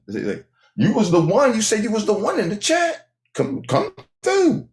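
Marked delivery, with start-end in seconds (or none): 1.6-4.7 high calling out. Real, not acted. Calling out to chatter.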